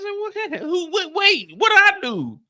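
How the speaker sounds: squeaky, high and hurt; mock-offended high voice; high indignant squeak. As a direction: protest in a high wounded voice. His indignant reply.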